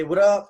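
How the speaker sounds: "what up" with a quick "what" and loud "up"; "what up" landing hard and high on "up"; stress on UP. Shown as a demonstration. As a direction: hurry "what", punch "up" in "what up". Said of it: "emphasis on the second syllable of the word".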